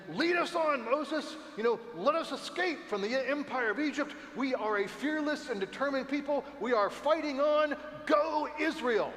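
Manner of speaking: high, loud rallying cry; hammed-up fearless Israelites cheering Moses on; rousing pretend battle cry; mock heroic crowd. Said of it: "they're all saying".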